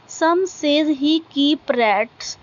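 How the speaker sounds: very slow, deliberate recitation, word by word; slow poem reading. Acted. Teacher reading a poem line to students, slow recitation. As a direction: read each line out slowly for the class to follow.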